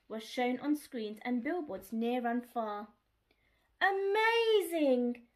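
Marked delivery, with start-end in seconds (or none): 3.8-5.3 loud, slow drawn-out delight; excited cry. Acted. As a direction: cry it out in amazement. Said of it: "cried Dolly's friends".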